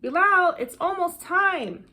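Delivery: loud, high call across the yard; father calling from the house. Acted. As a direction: call him in from outside, loud. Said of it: "they hear Abu call out".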